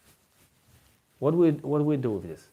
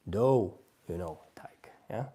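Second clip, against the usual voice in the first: whispered to self; 4 semitones lower than usual. Real, not acted. Whispering words to himself.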